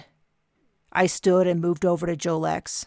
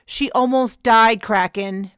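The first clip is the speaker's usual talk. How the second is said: angry rebuke; 4 semitones higher, 5 dB louder, slower than usual. Acted. Captain snaps at Kraken.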